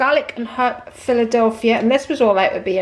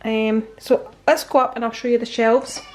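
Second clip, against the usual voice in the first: ordinary talk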